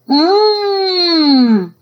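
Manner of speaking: long, high, loud sleepy whine; whining groan. Acted. Casey scolded awake by mom, whining groan. Sleepy girl protests being woken.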